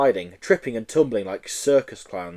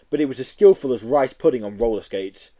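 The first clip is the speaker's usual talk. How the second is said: ordinary delivery, nothing marked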